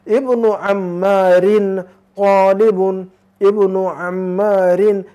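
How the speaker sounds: इब्नु अम्मारिन तालिबुन said very slowly, a clear ain in अम्मारिन; Arabic ain. Shown as a demonstration. Teacher modelling the phrase with the ain sound, very slowly. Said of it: "आइन की आवाज़ निकालेंगे".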